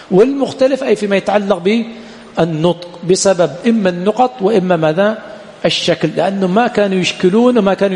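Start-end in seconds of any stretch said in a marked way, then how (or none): none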